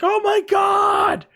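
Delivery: very high, slow, loud scream; terrified shout. Retold or imitated. Hitting the deck at the blast, high panicked scream. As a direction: scream as if the world ends. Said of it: "I was like".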